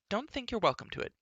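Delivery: put-on indignant young princess; princess crying out. A narrator's reading. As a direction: snap it at him as a spirited girl. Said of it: "cried Elenwy".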